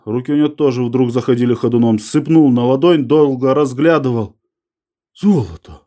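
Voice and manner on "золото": understated husky, stunned utterance; voice gone hoarse. A narrator's reading. Maksimov recognising the gold in his hand, voice gone hoarse. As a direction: say it with the voice giving out. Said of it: "сказал севшим голосом".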